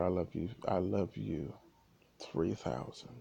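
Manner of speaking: soft, low, tender goodbye to his daughter; hushed loving farewell, almost fading out. Retold or imitated. Dying father tells his daughter he loves her, softly.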